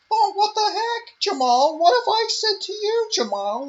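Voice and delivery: shrill mock reprimand aimed at Jamal; mocking Ariel Helwani. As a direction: whine at Jamal like a nagging pundit. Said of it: "you have fucking Ariel fucking Smelwani".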